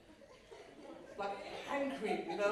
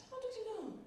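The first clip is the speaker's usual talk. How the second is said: put-on childlike question; 11 semitones higher, 7 dB quieter than usual. Asking mum as a child.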